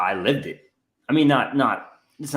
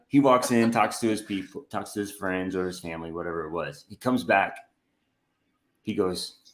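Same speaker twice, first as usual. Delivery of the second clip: ordinary talk, nothing marked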